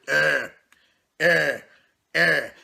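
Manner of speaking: low, lazy grunts of a sulky bodybuilder; moping grunts. Retold or imitated. Mocking gym bodybuilders who mope around, lazy low grunts. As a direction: grunt it out like you can't be bothered.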